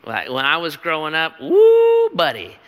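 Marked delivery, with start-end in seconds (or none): 1.4-2.1 high loud preacher's whoop; a sudden revival shout; fired-up whoop. Retold or imitated. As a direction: let out a worked-up woo. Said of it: "pastor would be all sweaty and fired up".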